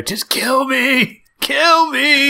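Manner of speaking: high, strained plea to die; begging old man. Retold or imitated. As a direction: whine like a worn-out old man. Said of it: "I bet his last words were".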